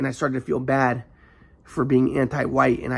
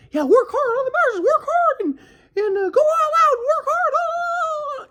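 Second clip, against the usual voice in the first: mocking grandpa. Grandson lampooning grandpa's work-hard preaching, squeaky high. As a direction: bark the work ethic like a squeaky drill sergeant. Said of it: "It's like".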